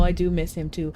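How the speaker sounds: ordinary talk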